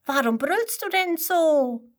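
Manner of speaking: high, small curious voice; curious little flea. A narrator's reading. Flea asking the kangaroo why it roars, high and curious. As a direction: ask like a tiny bug. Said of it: "Der fragt das Känguru".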